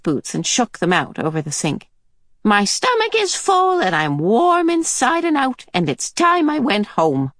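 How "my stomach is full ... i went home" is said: put-on very high, cosy old lady; contented old woman. Mrs Watson announcing she will leave, high and content. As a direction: purr it out, well fed.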